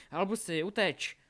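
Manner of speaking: loud, high shouted warning; urgent shout. A narrator's reading. Bound Scorpius warning Albus to flee, shouting. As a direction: yell to make a friend run. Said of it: "křikl na něj".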